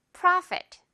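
bright clear Profit to copy; high model Profit. Shown as a demonstration. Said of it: "프라였습니다".

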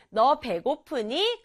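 normal talking voice

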